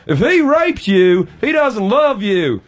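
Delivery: Doctor Phil. Retold or imitated. Comic retelling his botched audition, slow put-on Southern counsellor. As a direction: drag it out like a TV shrink. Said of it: "I just impersonated Doctor Phil".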